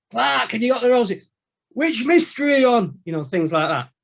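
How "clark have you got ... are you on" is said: high, loud call across the landing; shouting across landings. Retold or imitated. Inmate calling across the landing to a mate, loud shout.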